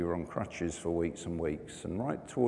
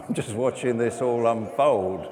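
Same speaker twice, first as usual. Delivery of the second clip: laughing it out, louder; 4 semitones higher, 8 dB louder than usual. Retold or imitated. Patient telling the nurse he's fine, laughing. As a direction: chuckle through the words, amused. Retold hospital chaos, laughing.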